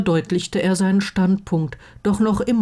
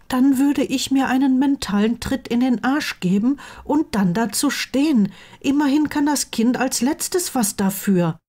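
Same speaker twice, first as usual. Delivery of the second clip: higher-pitched, emphatic character voice; principled best friend; 8 semitones higher than usual. Acted. Friend says he would stand by the child, emphatic. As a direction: state your conviction in a high voice.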